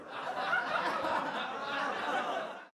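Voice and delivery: high hearty laugh at own punchline; laughing at his own joke; big laugh. Real, not acted. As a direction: let the laugh roll out.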